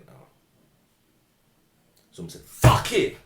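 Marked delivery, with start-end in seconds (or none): 2.4-3.2 retold outburst. Retold tearful outburst of Storm.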